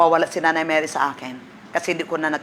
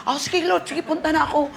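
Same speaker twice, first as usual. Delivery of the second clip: surrendering reply; 8 semitones higher than usual. Retold or imitated. Yielding reply to God.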